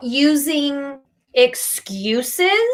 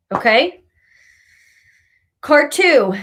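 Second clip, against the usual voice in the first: ordinary talk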